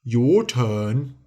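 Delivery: challenging giant. A narrator's reading. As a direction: dare him in a giant voice.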